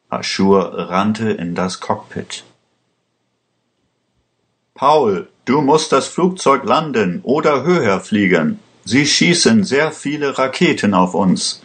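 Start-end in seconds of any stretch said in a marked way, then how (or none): 4.7-11.6 understated shout of warning to the pilot; narrated cry of alarm. A narrator's reading. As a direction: raise the pitch rather than the volume. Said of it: "schrie er".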